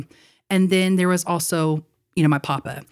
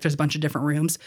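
ordinary delivery

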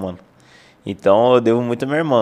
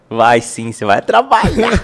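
stern mother voice; 6 dB louder, slower than usual. Retold or imitated. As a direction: play the bossy mother.

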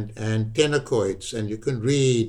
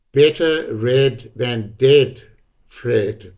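drawn-out, louder poetry-reading chant; slow declaimed poem; 5 dB louder, much slower than usual. Retold or imitated. New Zealand poet at a pub reading, slow chanted delivery. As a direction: recite it like a slogan poem, slowly. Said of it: "but the poem was".

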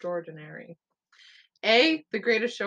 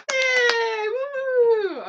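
excited cheer; 8 dB louder than usual. Real, not acted. Cheering a right answer.

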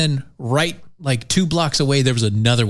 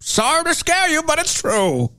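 town crier; 11 semitones higher than usual. Retold or imitated. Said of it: "His email read something like this".

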